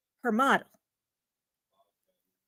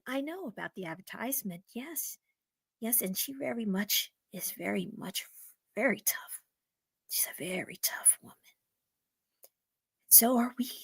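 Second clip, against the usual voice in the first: whispered, much softer nervous woman; hushed woman; 5 semitones lower, 13 dB quieter than usual. Acted. Woman answers softly, nervous.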